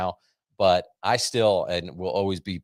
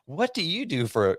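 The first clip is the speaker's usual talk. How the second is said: higher eager imitated question; imitating the doctor asking the wife about her work, high; 9 semitones higher than usual. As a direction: mimic the doctor's interest.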